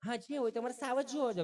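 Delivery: high, prim, over-precise Gujarati; high put-on voice of a fussy educated guest; overly educated city lady. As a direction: mince each word like a posh visitor. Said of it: "ચીપી ચીપીને બોલે".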